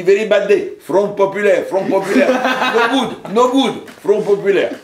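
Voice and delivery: imitated Arabic accent, emphatic and high-pitched; Arabic-accented English. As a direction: protest in a hammed-up Arabic accent. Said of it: "je ne parlais que anglais avec l'accent arabe".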